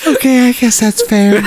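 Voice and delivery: high put-on stripper voice; English stripper. Stripper giving in to a broke customer, high voice. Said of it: "I'm just an English stripper".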